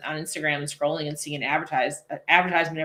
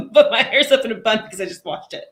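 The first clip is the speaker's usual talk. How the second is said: laughing; 4 semitones higher, 7 dB louder than usual. Real, not acted. Laughing about her hair.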